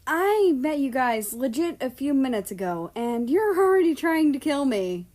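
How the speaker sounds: half-panicked grumble; nervous protest. A narrator's reading.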